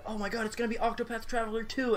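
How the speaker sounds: high, soft mock-excited gush; mock excitement. Mimicking fans hoping for a sequel, high mock gush. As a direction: gush like a hopeful fan, lightly. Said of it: "People were so excited".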